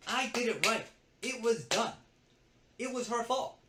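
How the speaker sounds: high, loud insistence; indignant protest. Real, not acted. Assistant defending his work after being blamed, indignant. Protesting he was not at fault.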